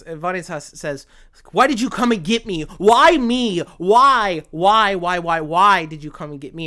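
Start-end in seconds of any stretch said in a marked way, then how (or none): 1.5-6.7 loud, high acted outcry; impassioned, accusing repeated plea; anguished demand. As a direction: wail each why at your rescuer. Acting terrified Vanitas confronting the vampire.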